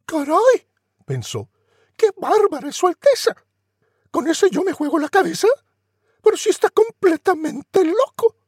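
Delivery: put-on very high, loud shocked girl; alarmed Cinderella. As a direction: gasp at the mad prince.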